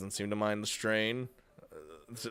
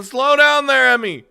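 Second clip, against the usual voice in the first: urgent shout; 12 semitones higher, 15 dB louder than usual. Acted. Calming a panicked girlfriend.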